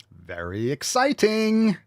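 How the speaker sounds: slow, high-pitched gleeful drawl; playful excitement. Pretend. Host gushing over gym couple, playful excitement. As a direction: stretch it out and leap up high.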